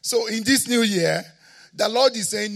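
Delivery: loud, high, shouted declarations to the church; preaching at full force. Real, not acted. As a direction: preach it out with rising fire.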